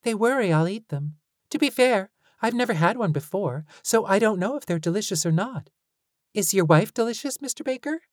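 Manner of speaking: high, fast, eager little voice; curious child voice. A narrator's reading. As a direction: ask innocently, like a small kid.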